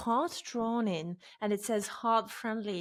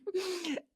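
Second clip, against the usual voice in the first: high, airy giggle at herself; short laugh; 10 semitones higher, 5 dB quieter than usual. Real, not acted. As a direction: laugh off your own fussiness. Laughs at own story.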